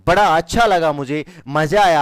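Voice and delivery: loud, happy burst of praise; delighted praise. Real, not acted. Teacher praising students' answer, delighted. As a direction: cheer the class on. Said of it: "मेरा दिल garden garden हो गया".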